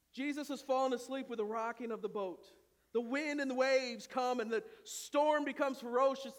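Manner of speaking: ordinary talk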